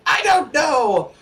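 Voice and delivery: high, loud, frantic outburst; panicked shout. Acted. Dwarf wizard shouts in despair after tossing his scrolls. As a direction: throw up your hands and shout in despair. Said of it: "throws his map ... into the air and says".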